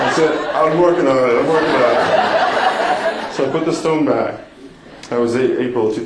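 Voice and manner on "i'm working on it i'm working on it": laughing. Retold or imitated. Joking reply to Tim's jab.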